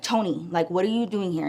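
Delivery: low, soft, unbothered question; very calm. Retold or imitated. Friend addresses her baby's father, very calm. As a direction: ask it quietly, keeping things level. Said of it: "It was very calm".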